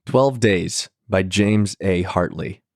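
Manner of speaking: plain reading voice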